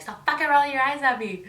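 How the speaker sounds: high, loud mock yell; yelling at friend. Yelling at Yvette to stop rolling her eyes. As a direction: snap it at her full force. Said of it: "And then I'll just be like".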